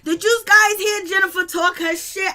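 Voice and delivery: loud, high, hyped-up exclamation; thrilled disbelief. Retold or imitated. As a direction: shout it to the room, amazed and delighted. Retells own thrilled reaction to confrontation.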